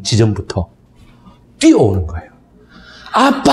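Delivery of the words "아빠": high, bright call of "아빠!"; a little girl calling out to her dad, lightly voiced; mild imitation of a toddler calling "아빠!"; child calling dad.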